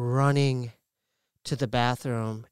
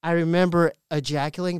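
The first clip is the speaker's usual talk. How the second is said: ordinary talk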